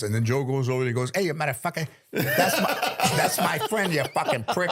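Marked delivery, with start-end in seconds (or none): 1.1-4.7 higher mock-angry Joe, cracking up mid-line; hammed-up tough guy defending a friend; Joe's voice. Joe confronts the rude guest, higher mock fury. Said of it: "those two dudes fighting with those voices".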